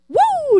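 high playful flying woo; dad flying his daughter down the staircase with a high woo; swooping woo. Retold or imitated.

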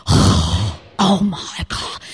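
low, slow, drawn-out "Oh my God"; mock groan. Imitating a sulky boy's eye-roll, a mock groan. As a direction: drag it out like a fed-up kid. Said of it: "the normal".

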